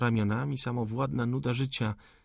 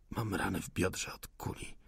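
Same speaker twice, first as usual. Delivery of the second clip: soft, subdued reply; weak wounded answer; 3 semitones lower, 8 dB quieter than usual. A narrator's reading. Wounded insurgent tells of his injury, weakly. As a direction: answer quietly, low on strength.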